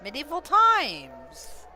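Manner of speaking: excited exclamation. Real, not acted. Answering "What is this?", excited exclamation. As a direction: belt it out with long, high vowels.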